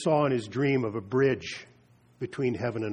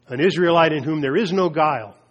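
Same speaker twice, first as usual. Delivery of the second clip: louder, ringing declaration; proclaiming aloud; 2 semitones higher, 8 dB louder than usual. Acted. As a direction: announce it to the crowd. Preacher repeats Jesus' proclamation.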